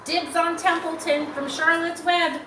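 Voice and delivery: calling out. Acted. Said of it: "Sydney called out".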